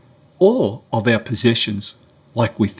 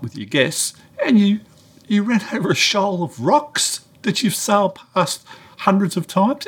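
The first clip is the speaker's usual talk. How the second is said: mock telling off; 7 semitones higher than usual. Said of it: "And then I gave it to him".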